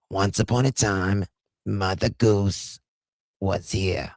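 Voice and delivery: a hammed-up hint of a bedtime story; mock bedtime story. Mocking Janie as a bedtime storyteller, sing-song storybook voice. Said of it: "A S M R bedtime stories".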